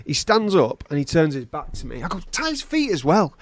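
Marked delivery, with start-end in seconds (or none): 2.3-3.3 high-pitched, put-out command; exasperated order. Acted. Torturer as the captive turns his back, exasperated order. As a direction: call it out to the helpers.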